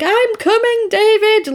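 high, hammed-up cry of "David"; a hammed-up rallying cry, pitched high; retelling Diane grabbing a leg, mock yell. Diane grabs his leg.

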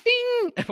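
high sung bell ding; ding sound effect. Retold or imitated. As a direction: imitate a bell with your voice. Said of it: "I wish I had a sound effect".

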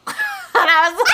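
high squealing laugh. Real, not acted. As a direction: burst out laughing mid-sentence. Cracks up mid-story.

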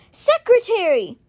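high, drawn-out call. A narrator's reading. Aaron calls for his secretary, calling out. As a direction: call after someone walking away.

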